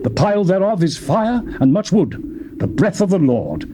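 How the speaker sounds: usual speaking voice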